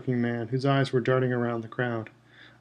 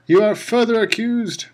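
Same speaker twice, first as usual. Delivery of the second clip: higher, louder furious bellow; 9 semitones higher, 6 dB louder than usual. A narrator's reading. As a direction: bellow the charge down at him. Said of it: "bellowed Mister Crouch".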